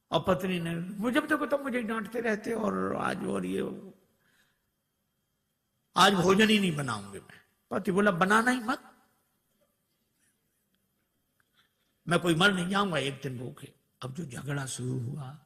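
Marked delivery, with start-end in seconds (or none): 0.8-7.4 wife's fake angry scolding, pitched up; hammed-up sham row, voice raised high; mock quarrel. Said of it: "झगड़ा करना शुरू किया". 8.1-13.7 mock quarrel. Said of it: "झगड़ा करना शुरू किया".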